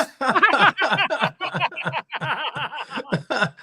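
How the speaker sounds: long, high belly laugh; helpless run of high cackling laughter; hysterical laughter. Real, not acted. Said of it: "Oh, is that hysterical?".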